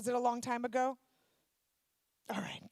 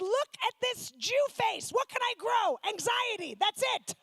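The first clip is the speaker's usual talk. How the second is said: exasperated mock shout; 8 semitones higher than usual. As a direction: throw it out in comic exasperation. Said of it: "And I said".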